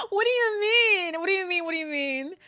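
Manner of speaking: mock wailing. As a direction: start loud and high, then let it sink. Mock wailing reaction to the game.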